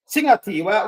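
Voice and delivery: ordinary talk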